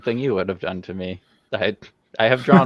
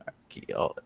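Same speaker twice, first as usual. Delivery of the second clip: soft whisper while spelling; 4 semitones lower, 10 dB quieter than usual. Real, not acted. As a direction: whisper it to yourself. Spelling word to herself.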